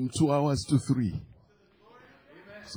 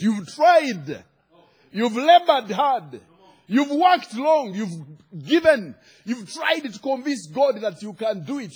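raised, emphatic pulpit declarations; full-force preaching; 7 semitones higher, 6 dB louder than usual. Real, not acted. Preacher telling congregation God is taking over, forceful preaching. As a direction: drive each phrase home to the crowd.

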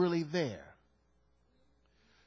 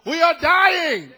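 shouted, slow and much higher, almost wailing; mayor crying out; 11 semitones higher, 12 dB louder, slower than usual. Retold or imitated. Preacher voicing the mayor of San Juan begging for help, desperate shout. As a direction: cry it out like a desperate plea for help. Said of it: "She says".

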